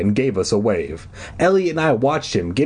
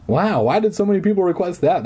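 ordinary delivery

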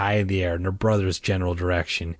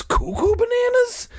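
silly high voice; 3 dB louder than usual. A narrator's reading. Mocking his crazy dad.

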